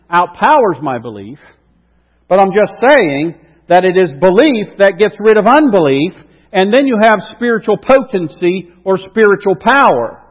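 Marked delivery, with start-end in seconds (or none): 2.3-10.2 rising into full-force preaching, louder and higher. Acted. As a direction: preach it out, building to a peak.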